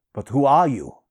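loud, forceful question; demanding. A narrator's reading. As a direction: demand it firmly, falling at the end. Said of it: "demanded Conan".

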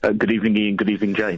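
posh English greeting; plummy RP accent. Real, not acted. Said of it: "Don't stop doing the posh voice".

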